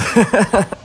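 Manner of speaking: high-pitched amused laugh; high burst of laughter. Real, not acted. Laughs at own story.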